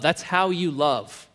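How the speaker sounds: ordinary delivery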